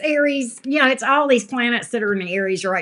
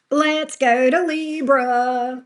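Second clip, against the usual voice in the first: slow drawn-out call; 3 semitones higher, much slower than usual. Acted. Announcing the next sign, drawn out.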